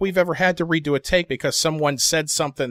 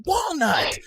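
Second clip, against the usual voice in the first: higher, louder exasperated outburst; 8 semitones higher, 4 dB louder than usual. Retold or imitated. Exasperated player scolding Walnut mid-scene, high-pitched exasperation. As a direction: drag out the question in mock disbelief.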